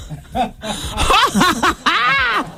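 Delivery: big burst of laughter. Real, not acted. Laughs after admitting breathlessness.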